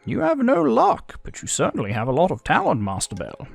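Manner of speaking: compliment an opponent in a character voice; game character. Acted.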